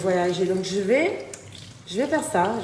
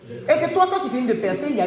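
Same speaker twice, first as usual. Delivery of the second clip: louder, higher scolding; 5 semitones higher, 5 dB louder, faster than usual. Real, not acted. Scolding a worker about the mess, loud. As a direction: tell him off loudly.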